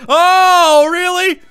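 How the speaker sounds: very loud, high incredulous cry; indignant outburst. Real, not acted. Player reacting to an attack, loud indignant disbelief. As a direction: blurt it out in disbelief.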